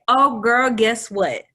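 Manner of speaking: gushing wrong-gender greeting. Retold or imitated. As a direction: gush "girl" at him like an old friend. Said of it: "And I say".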